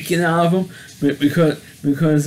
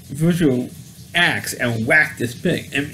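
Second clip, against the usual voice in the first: ordinary talk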